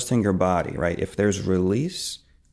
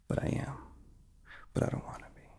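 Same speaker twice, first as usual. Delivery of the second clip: whispered inner voice; 12 dB quieter, faster than usual. Shown as a demonstration.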